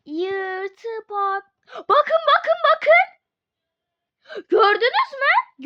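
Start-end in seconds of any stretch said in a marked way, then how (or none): 1.8-3.1 loud, high insistent calls; excited pointing at the screen; urgent look. Real, not acted. 4.5-5.6 loud, high urgent question; excited. Real, not acted. Excited pointing out.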